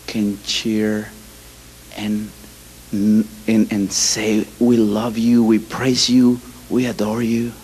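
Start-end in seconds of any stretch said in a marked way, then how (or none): none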